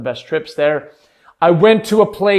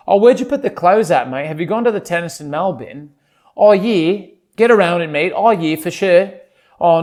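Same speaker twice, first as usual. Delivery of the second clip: hammed-up Aussie chat; put-on broad Australian accent. Shown as a demonstration. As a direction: play a matey Australian, lilting through the phrases. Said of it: "my word for my Australian accent is clothes".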